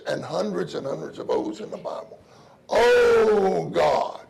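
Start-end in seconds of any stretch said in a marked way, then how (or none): 2.7-4.2 loud, higher, slowing calls to God; crying out in prayer. Shown as a demonstration. Preacher modelling prayer for the saints, loud fervent crying out. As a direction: call out to God with everything.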